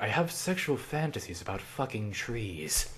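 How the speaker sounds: slow, hushed confession; acted patient admitting something shameful; confiding patient. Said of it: "if I had a patient and they're like".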